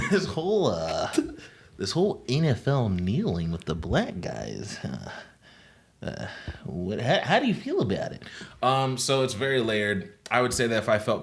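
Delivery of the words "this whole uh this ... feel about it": a hint of a hesitant gym guy.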